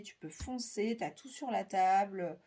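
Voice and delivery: ordinary delivery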